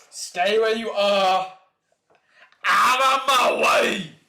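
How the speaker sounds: slow, loud, high shout; a slow, bellowed warning; furious shout. Acted. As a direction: yell at them to stay back. Shouted line read from manga.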